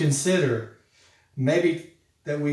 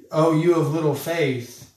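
slow, teasing, lightly voiced Jesus; mock-playful rebuke, drawn out; playful kidding; 2 semitones higher, 4 dB louder than usual. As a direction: tease them fondly, like a friend. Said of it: "more playful or kidding".

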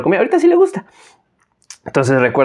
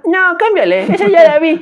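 put-on much higher old lady voice, dismissive; grandma refusing; 14 semitones higher, 4 dB louder than usual. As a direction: brush it off like a stubborn granny.

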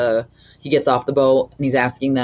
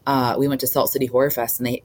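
ordinary delivery

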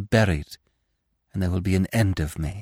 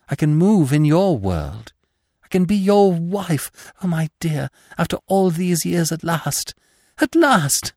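higher and louder until a soaring cry on at last; rising joy; 8 semitones higher, 4 dB louder than usual. Acted. Ghost telling her lover she can be his wife, ecstatic cry. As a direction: let the joy break loose.

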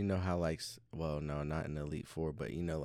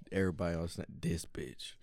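ordinary talk, nothing marked

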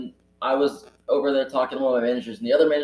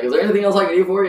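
fast, high, louder service patter; chirpy customer service; 7 semitones higher, 5 dB louder, much faster than usual. Retold or imitated. Cashier to the told-off lady, chirpy customer-service voice. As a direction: rattle it off brightly, pitch lifted.

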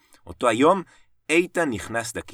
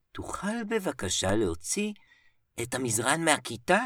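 soft, higher-pitched polite request; 4 semitones higher than usual. A narrator's reading. Teacher asking Eitan to remove the mattress, soft voice. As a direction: ask gently, voice lifted high and kept soft. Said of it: "ואמר לאיתן בקול כזה רך".

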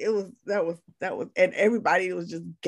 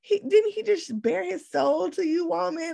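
higher, incredulous outburst; retold disbelief; 11 semitones higher than usual. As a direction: act out shock at the screen. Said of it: "I'm like".

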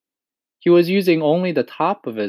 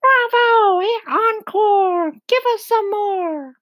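very high, drawn-out cheering shout; excited shout; 4 dB louder, slower than usual. Acted. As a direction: shout for an encore in a shrieky high voice. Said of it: "Miss Spider stood up and shouted".

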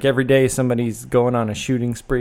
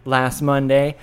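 usual speaking voice

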